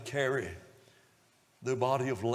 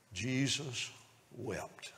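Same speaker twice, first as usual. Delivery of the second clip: much softer, slow and solemn; hushed; 8 dB quieter, much slower than usual. A narrator's reading. Preacher hushing the congregation at Jesus's tears. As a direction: let it drop almost to nothing.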